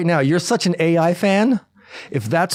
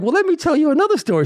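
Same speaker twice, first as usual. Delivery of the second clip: quick, higher mock wife's retort; 13 semitones higher, faster than usual. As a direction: toss back her comeback, lightly voiced. Wife retorting with her own story.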